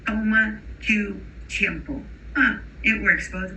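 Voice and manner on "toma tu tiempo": toma tu tiempo with aspirated English T sounds, slow; English accent. Retold or imitated. Teacher imitating an English speaker learning Spanish, English accent. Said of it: "is gonna say".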